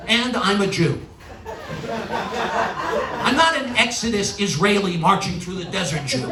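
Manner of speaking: ordinary talk, nothing marked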